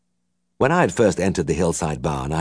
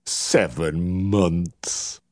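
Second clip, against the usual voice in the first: very slow, drawn-out and condescending; indulgent repeat; much slower than usual. A narrator's reading. Uncle repeating the vet's answer, slow and indulgent. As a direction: savour the words with pity. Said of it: "Uncle smiled indulgently".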